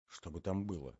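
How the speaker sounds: whispered. A narrator's reading. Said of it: "прошептал молодой человек".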